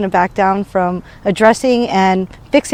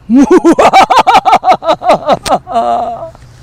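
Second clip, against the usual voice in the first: Dolores Umbridge-style evil giggle; high, sugary villain laugh; evil teacher laugh; 6 semitones higher, 4 dB louder than usual. Pretend. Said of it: "So here's my evil teacher laugh".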